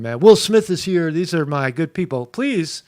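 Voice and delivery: ordinary talk, nothing marked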